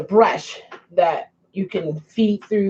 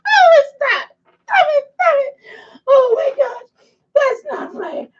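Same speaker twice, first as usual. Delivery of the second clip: high, loud, laughing through the words; laughing hard; 5 dB louder than usual. Real, not acted. Laughing at herself to the viewers, high and breathless. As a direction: talk through a real laughing fit. Laughing fit, mascara running.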